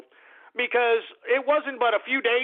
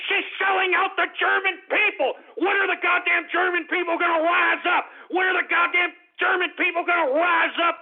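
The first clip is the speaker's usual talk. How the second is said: angry shout; 8 semitones higher than usual. Real, not acted. Ranting at Germans' inaction.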